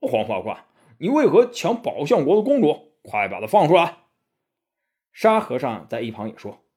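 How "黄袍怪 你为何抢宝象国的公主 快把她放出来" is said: Bajie yells at the demon, louder and higher; a blustering pig-spirit shouting a demand; scolding shout. A narrator's reading. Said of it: "八戒骂道".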